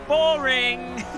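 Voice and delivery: loud, high, drawn-out jeer; mock heckle. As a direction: call it out like a heckler. Mock heckle cutting off serious talk.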